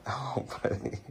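soft tender whisper. Real, not acted. Mother whispers to sleepy baby.

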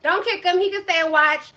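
normal talking voice

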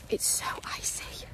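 hushed whisper. Real, not acted. Vlogger to viewers on an icy dog walk, whispering. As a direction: breathe it out as a whisper.

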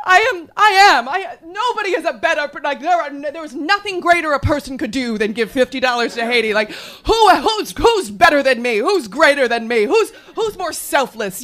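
overwhelmed shopper wailing at the cashier, loud and high; sobbing self-praise. Retold or imitated. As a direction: blubber it out through happy tears. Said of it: "suddenly I'm like, it's overcome with".

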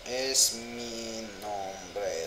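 stretched-out slow words; very slow repeat. Shown as a demonstration. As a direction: drag every syllable.

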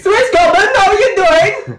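very high cartoon king greeting; King Julian. Cartoon king greeting a viewer, squealed comic accent. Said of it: "stop with the accent now".

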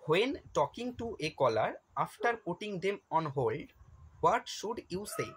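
very slow, careful reading aloud; slow question read. Shown as a demonstration. Teacher reading an exam question to students, very slow. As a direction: read each English word out slowly for the class.

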